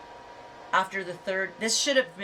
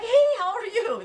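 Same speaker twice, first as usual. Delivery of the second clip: mimicking his bright, much higher, louder, slower hello; overly cheerful greeting; 14 semitones higher, 7 dB louder, slower than usual. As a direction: squeal it like a guy thrilled to get a call. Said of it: "He's like".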